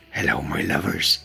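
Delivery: put-on Bristol greeting; Bristol accent. Host greets the viewers in a Bristol accent. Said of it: "that was my Bristol accent".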